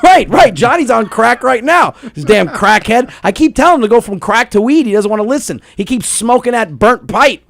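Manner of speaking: loud, high hammed-up complaint; joking loud rant about his cohost; mock ranting. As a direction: rant about the crackhead loudly for laughs. Mocking Johnny as crackhead.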